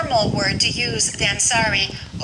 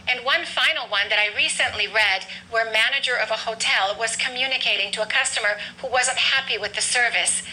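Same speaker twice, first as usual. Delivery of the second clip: ordinary talk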